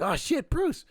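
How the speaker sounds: high, loud burst of surprise; shocked exclamation. Retold or imitated. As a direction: blurt it out, re-enacting the shock. Acts out shock on hearing Bruce.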